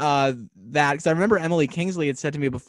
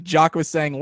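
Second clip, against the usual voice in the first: ordinary talk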